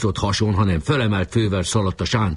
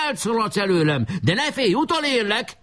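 high-pitched taunting call; shouted threat; 13 semitones higher than usual. A narrator's reading. Enemy chief pursuing the fleeing leader, shouting. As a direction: call after a runner, pitched high throughout. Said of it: "kiáltott rá".

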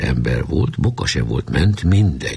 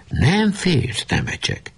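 mock call, leaping high then dropping; retold shout; 10 semitones higher, slower than usual. A narrator's reading. Nemecsek quotes what the enemy captain shouted, mock shout. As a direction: imitate a challenging call without real volume. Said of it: "és rászólt".

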